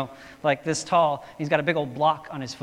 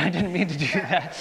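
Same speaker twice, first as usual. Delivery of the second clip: laughing; 2 semitones higher than usual. Real, not acted. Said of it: "laughing apology".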